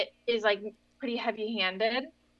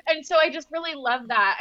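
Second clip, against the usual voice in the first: usual speaking voice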